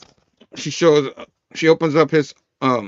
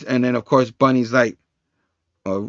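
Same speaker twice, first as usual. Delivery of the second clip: usual speaking voice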